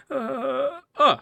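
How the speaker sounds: high whimpering sound; whimper, barely recovered from terror; shaken whimper. Acted. Robber whimpers after ordeal.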